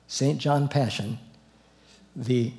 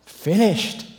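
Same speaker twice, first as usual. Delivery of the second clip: finished cried out high and loud; triumphant shout from the cross; loud cry; 6 semitones higher, 5 dB louder than usual. Acted. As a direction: proclaim it as the last shout. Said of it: "and he said".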